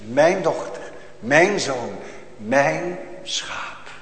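soft, slow, fading to a breath; tender hushed voice. Pretend. Preacher voicing God comforting the soul, tender and hushed. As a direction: speak like a shepherd soothing a lamb.

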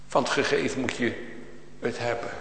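usual speaking voice

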